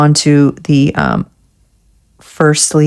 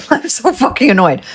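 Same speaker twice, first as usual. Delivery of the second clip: annoyed laugh; 6 semitones higher than usual. Real, not acted. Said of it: "I'm annoyed".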